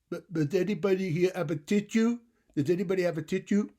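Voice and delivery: high, loud, squeaky little bug voice; tiny flea's plea. Acted. The stuffy flea begging the barn animals for a tissue, high and squeaky. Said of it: "the flea's garbled plea when he asked".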